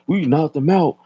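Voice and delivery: high mocking quote of a brag; mock announcement. Mocking the YouTube brag, high braggart voice. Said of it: "announce that shit".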